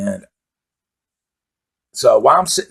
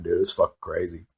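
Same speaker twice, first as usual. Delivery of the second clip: normal talking voice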